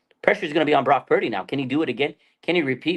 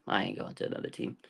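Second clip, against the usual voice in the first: whispered answer; 4 semitones lower, 12 dB quieter than usual. Retold or imitated. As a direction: whisper it, much softer. Rhetorical question then answer.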